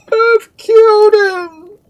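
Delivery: very high, drawn-out scream; agonised scream. A narrator's reading. Duke beside the corpse, screaming in agony. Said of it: "again screamed the Duke in agony".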